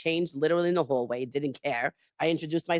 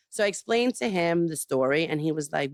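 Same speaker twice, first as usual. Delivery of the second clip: normal talking voice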